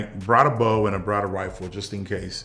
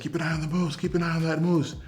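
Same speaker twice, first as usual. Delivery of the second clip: higher, urgent, pressing dad voice; urgent call; 8 semitones higher, faster than usual. Retold or imitated. Dad urging his son mid-hunt, higher and urgent. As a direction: hiss it up high like the moose might bolt.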